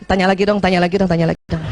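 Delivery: fast, laughing plea to be asked again; pleading inner voice. Retold or imitated. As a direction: rush the coaxing lines with a giggle. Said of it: "Dalam hati masih juga".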